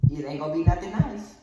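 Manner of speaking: loud threat with a lifted voice; mom's threat. Acted. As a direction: warn them loudly as a fed-up mother. Mother's threat, then son's retort.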